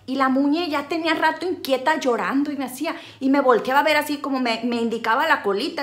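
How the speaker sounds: ordinary talk, nothing marked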